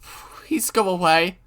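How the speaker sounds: slow plea building into a high scream; terrified pleading. Acted. Keiichi begging Rena at the door, building to a scream. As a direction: beg her to leave, panic mounting.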